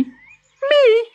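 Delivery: put-on very high baby tortoise voice, aghast; a tiny baby protesting the long trip. A narrator's reading. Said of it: "said the baby".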